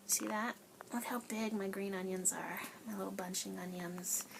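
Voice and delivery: soft whispered aside. Real, not acted. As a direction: hush it like a secret. Whispered aside about onions.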